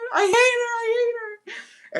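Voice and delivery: high, loud fake crying I hate her; hammed-up sobbing. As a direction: wail it on your knees.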